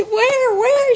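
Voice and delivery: high, loud excited cries; excited squeal. Retold or imitated. Mother hears she'll be a grandmother, squealing with excitement. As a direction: squeal it like news you can't believe. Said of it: "She got so excited".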